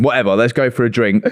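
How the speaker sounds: ordinary talk, nothing marked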